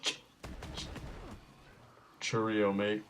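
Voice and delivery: halting, stuttered attempt at British; badly faked British accent, struggling to get it out; failed British accent. Gamer forced at gunpoint to do British, failed accent. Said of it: "Do a British accent".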